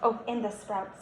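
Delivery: ordinary delivery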